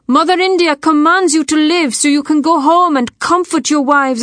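high, carrying oration. A narrator's reading. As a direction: proclaim it to the troops as their leader. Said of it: "She raised her voice".

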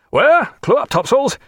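high-pitched urgent command; urgent order. A narrator's reading. Captain ordering sail on sighting a ship, urgent and high. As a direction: fire off a sailing order.

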